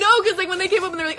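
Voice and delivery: fast, high, loud words through laughter; laughing rush. Real, not acted. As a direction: race through it while cracking up. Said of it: "laughing explanation".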